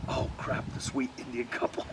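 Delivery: soft, breathy oh crap under the breath; whispered aside. Retold or imitated. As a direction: hide your dismay from the couple. Panicked aside about watching couple.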